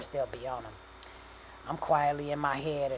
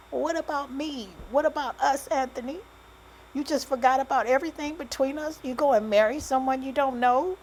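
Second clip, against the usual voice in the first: high-pitched, hurt outburst; upset confrontation; 10 semitones higher, 5 dB louder than usual. A narrator's reading. Pregnant woman confronting the man who married someone else, upset. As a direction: demand an answer, voice raised high.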